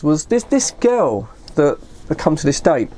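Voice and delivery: plain reading voice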